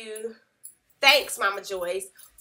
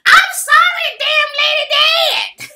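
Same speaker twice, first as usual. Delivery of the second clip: high, loud shrieked outburst; shrill, slowed-down outraged yell; mock snapping shout; 15 dB louder, slower than usual. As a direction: shriek it like an old lady snapping. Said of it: "And then Joyce snaps".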